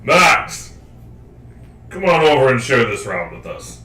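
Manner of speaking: bold, hearty barbarian calling out. Acted. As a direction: boom it like a rowdy barbarian at the bar. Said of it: "back to my barbarian tone".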